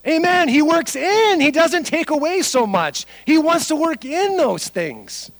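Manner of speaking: loud, high, impassioned proclamation; full-force preaching. Real, not acted. Preacher driving home that God works in, shouted fervently. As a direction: shout it out at the sermon's peak.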